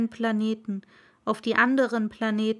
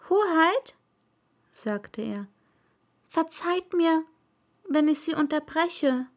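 a touch of a courteous little prince; polite prince; 6 semitones higher than usual. Acted. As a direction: address his highness politely. Prince addresses king.

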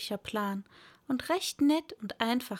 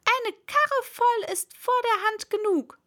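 high, loud announcing voice; rabbit's voice; 11 semitones higher, 6 dB louder than usual. Acted. Rabbit directing the others outside, high loud voice. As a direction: declare it loudly in a high voice. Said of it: "hörte das Kaninchen sagen".

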